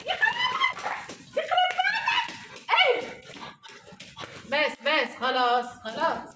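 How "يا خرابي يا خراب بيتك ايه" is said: very high, slow shrieked exclamations; alarmed shriek. Real, not acted. Woman shrieking as her duck startles her.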